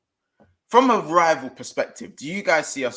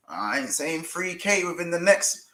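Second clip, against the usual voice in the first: rising, much higher hype; excited; 7 semitones higher, 4 dB louder than usual. Real, not acted. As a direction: build the hype, voice climbing high. Hyping subscriber goal to viewers.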